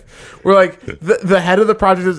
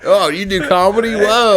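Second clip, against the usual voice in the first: mock impressed. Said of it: "They're like".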